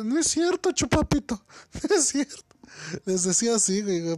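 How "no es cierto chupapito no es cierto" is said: high, teasing sing-song voice, breaking into a laugh; Eddie's mock denial. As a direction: parrot the women in a much higher pitch, mocking. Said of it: "el Eddie le decía". (burla aguda y cantadita, entre risas)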